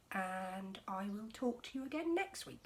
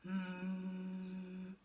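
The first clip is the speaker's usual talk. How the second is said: fridge hum; 3 dB quieter than usual. Acted.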